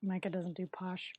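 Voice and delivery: stage whisper to the listeners; breathy teasing aside; hushed jab at Micah. Real, not acted.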